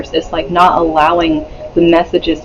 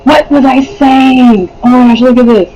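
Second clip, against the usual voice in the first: surprised; 6 semitones higher than usual. Real, not acted. Startled by a card.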